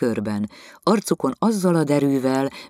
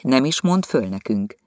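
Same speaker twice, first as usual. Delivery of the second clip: plain reading voice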